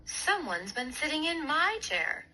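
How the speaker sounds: indignant mother bear; Mama Bear finding her porridge eaten. A narrator's reading. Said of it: "said Mama Bear".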